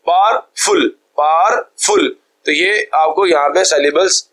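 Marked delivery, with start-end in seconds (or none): 0.1-0.9 powerful broken as power, pause, फुल; split into syllables. Shown as a demonstration. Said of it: "ये दूसरा syllable है". 1.2-2.1 powerful as power, gap, फुल, pitched higher; split into syllables. Shown as a demonstration. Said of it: "breaks दे रहे हैं".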